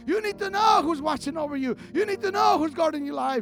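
high, insistent repeated exhortation; fervent preaching. Real, not acted. Assuring the church God watches over them, fervent. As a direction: drive it home at full preaching force. Preacher urges congregation at full force.

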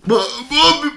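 high cartoon false start; Patrick. Podcaster practising Patrick, high stammered attempt. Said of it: "uma imitação do Patrick".

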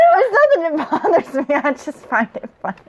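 high and laughing, defensive. Real, not acted.

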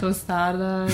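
TOSTADA said in a put-on Spanish pronunciation; playful Spanish pronunciation. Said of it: "Just do the tostada over and over again".

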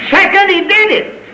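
high, ringing announcement; fervent preacher's cry; exultant proclamation. Real, not acted. Preacher proclaims the second step.

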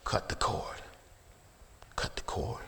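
whispered, soft command; quiet, voiceless pulpit aside; hushed repetition. Real, not acted. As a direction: drop to a whisper to let it sink in. Hushed repeat after the shout.

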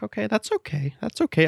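high, softened "it's fine"; accepting reassurance. Retold or imitated. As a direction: talk yourself into being okay with it. Talking himself into accepting bad news.

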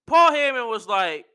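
usual speaking voice

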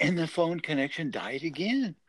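ordinary delivery

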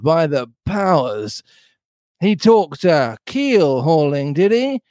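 slow, swooping pirate ranting; excited pirate Silver. Acted. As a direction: relish each word, stretching to a drawn-out threat.

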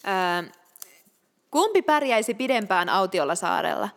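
usual speaking voice

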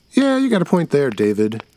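put-on a kid siding with David; boy voice. Another kid agrees.

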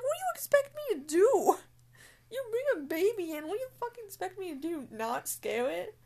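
loud, high ranting at the guests; exasperated rant. Retold or imitated. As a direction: rant in disbelief. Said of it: "It's like".

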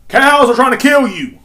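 high, very loud, drawn-out warning; mock alarm shout. Podcaster ridiculing a rural gangster wannabe, mock alarm shout. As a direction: holler it like an absurd danger warning. Hammed-up warning, then repeated as slogan.

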